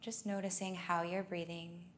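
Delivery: unhurried meditation leading, leaving space for breath; guided meditation. Acted. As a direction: guide them gently inward, phrase by phrase.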